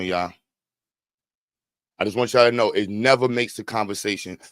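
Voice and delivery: ordinary delivery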